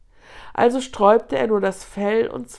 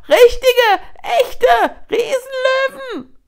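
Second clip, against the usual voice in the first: high, loud terrified wail; panicked scream; 10 dB louder, slower than usual. A narrator's reading. Terrified hyena warning of giant lions, panicked scream. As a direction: shriek each word in alarm. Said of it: "schrie Fledderohr".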